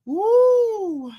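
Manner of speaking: long high-pitched "woo"; high whoop. Real, not acted. Host whooping after a laugh at the gossip, high whoop. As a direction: let out a drawn-out, amused whoop.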